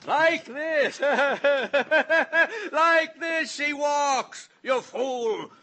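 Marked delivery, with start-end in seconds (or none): 1.0-2.7 high, wild crazed laugh; mad cackle. Acted. Killer mocks uncle. 2.7-4.5 slow, drawn-out shouted sneer; mocking mimic. Mimicking the girl's walk.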